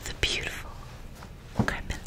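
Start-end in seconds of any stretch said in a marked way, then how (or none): none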